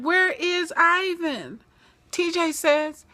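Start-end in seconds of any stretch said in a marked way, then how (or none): 0.0-1.6 slow, higher demanding question; slow mock demand; asking for Ivan. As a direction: drag each word out. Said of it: "they saying".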